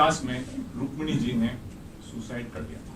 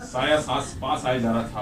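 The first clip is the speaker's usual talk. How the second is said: ordinary delivery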